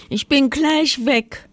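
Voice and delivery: high little-bear voice; high, eager reassurance; promising little bear. A narrator's reading. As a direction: promise quickly in a high, small voice. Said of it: "versprach der kleine Bär".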